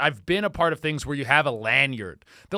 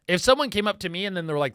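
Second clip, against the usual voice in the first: usual speaking voice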